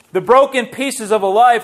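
normal talking voice

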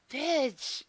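ordinary delivery

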